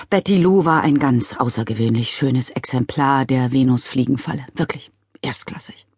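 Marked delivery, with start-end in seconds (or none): 4.5-5.9 soft unvoiced whisper. A narrator's reading. Father mourning his plant, whispering. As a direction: breathe it in mourning.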